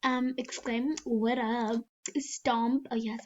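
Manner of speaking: ordinary delivery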